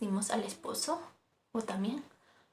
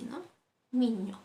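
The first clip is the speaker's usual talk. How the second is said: usual speaking voice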